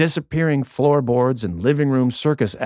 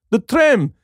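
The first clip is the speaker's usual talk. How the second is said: put-on heavy Russian accent; 12 semitones higher than usual. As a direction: exclaim it in a thick Russian accent. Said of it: "In his heavy Russian accent".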